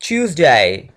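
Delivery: "ट्यूसडे" loud, as "chooz-day"; drawn-out "ट्यूसडे" starting with ch; English pronunciation. Shown as a demonstration. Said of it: "इसको कैसे pronounce करना है?".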